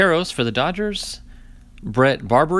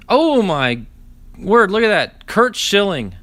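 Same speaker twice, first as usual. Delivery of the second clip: loud and higher, a delighted outburst; thrilled at a great pull; excited; 6 semitones higher, 4 dB louder, slower than usual. Real, not acted. Collector thrilled to pull a Curt Schilling card, excited.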